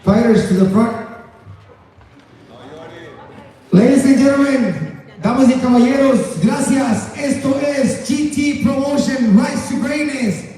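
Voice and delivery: booming ring announcer hype; lucha libre announcer. Acted. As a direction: call it out to the crowd like a fight night. Closes event as announcer.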